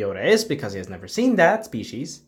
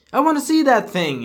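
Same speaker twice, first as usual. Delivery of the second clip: high squeaky trooper; 12 semitones higher, 4 dB louder than usual. Retold or imitated. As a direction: voice jumps way up, excited. Scout trooper voiced in retelling.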